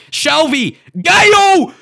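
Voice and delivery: high shout, building to a yell; excited shouting. Real, not acted. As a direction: yell the player's name as he shoots. Yelling players' names at chance.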